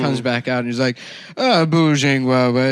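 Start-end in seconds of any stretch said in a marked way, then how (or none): 1.4-2.7 mock Chinese. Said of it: "speaking Chinese".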